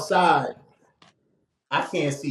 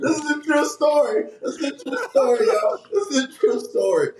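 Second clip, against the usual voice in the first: insisting; 8 semitones higher than usual. Real, not acted. Insisting amid laughter.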